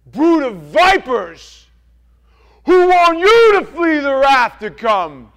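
furious prophet's roar. Acted. John the Baptist roaring at the Pharisees and Sadducees. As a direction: bellow it at them like John the Baptist. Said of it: "and he goes".

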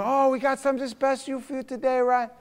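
high-pitched, eager host impression; put-on high voice pitching the special; restaurant host. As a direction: imitate a welcoming Italian restaurant owner. Voices the restaurant family greeting.

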